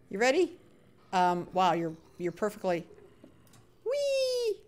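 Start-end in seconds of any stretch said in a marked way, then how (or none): 3.8-4.6 very high, sustained squeal; thin high-pitched whee; playful whee. Real, not acted. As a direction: squeal it like going down a slide. Playing as the zombie drops.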